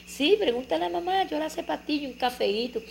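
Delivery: put-on high, sweet wheedling child voice; butter up papa like a little girl; coaxing little girl. Daughter sweet-talking her father, high and coaxing. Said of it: "jaboneándolo".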